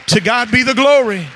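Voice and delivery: booming church proclamation. Real, not acted. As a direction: raise it like a call to the congregation. Preacher repeats praise line louder.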